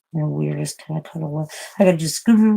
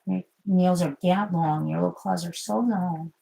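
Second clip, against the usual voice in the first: ordinary talk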